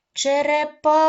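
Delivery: "Черепаха" called loud and high, "па" held long; calling out. Shown as a demonstration. Teacher calling to find the stress, "Черепаха" shouted with a long "па". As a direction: hail the turtle, stretching "па" in "Черепаха". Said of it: "Гукаємо".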